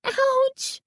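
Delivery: very high shriek of pain; shrill, drawn-out ouch. A narrator's reading. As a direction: shriek it, hurting. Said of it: "Carly Beth shrieked".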